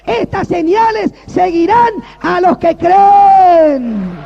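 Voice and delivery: drawn-out shouted verse; full shout. Acted. Preacher shouting scripture to the congregation, long and loud. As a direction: bellow the verse slowly.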